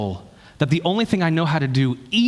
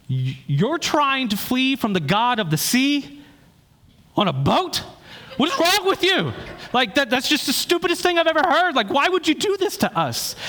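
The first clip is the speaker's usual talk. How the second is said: much higher, incredulous shriek of disbelief; mock the sailors' stunned disbelief, voice up high; alarmed sailors; 11 semitones higher than usual. Terrified sailors scolding Jonah, high incredulous voice. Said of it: "they're just alarmed by this".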